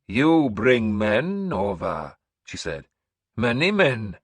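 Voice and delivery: slow, high, loud proclamation; old seeress announcing. Acted. Seeress announcing to the hall, high and slow. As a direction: proclaim like an eerie prophetess.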